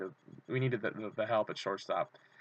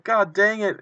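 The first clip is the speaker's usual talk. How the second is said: frustrated curse; 8 semitones higher, 6 dB louder, slower than usual. Retold or imitated. Ball dropped onto field.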